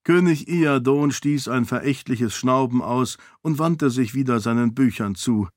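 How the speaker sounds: ordinary delivery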